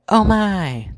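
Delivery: hammed-up high cry of glee; mock delight. A narrator's reading. Dark Queen faking delight for the fairies, loud and high. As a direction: overplay the joy for hidden listeners. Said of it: "the Dark Queen cried out".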